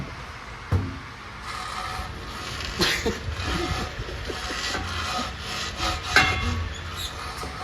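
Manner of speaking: long airy unvoiced laugh; helpless wheezing laugh; breathy laughter. Real, not acted. Laughing again at friend.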